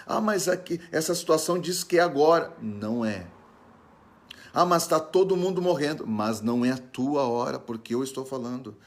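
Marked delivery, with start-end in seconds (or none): 0.1-2.6 high-pitched mock objection; acted complaint in a raised voice; protesting believer. Voicing a worried believer's objection. 4.5-6.1 high-pitched mock objection; acted complaint in a raised voice; protesting believer. Voicing a worried believer's objection.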